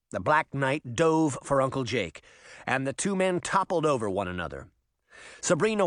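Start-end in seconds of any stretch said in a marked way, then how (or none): none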